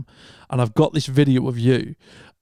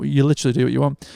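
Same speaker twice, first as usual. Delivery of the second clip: ordinary talk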